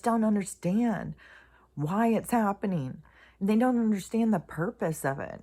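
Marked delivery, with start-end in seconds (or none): none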